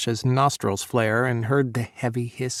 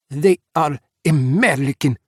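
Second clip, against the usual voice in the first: louder, bitter retort; a louder spat-out bitter retort; spitting out the words; 3 semitones higher, 6 dB louder than usual. A narrator's reading. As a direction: spit it out with contempt. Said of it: "Sasha started to spit".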